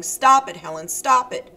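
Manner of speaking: loud, urgent shout; a loud, insistent denial; panicked shouting. Acted. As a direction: keep shouting, fighting off panic. Randy shouting Helen off.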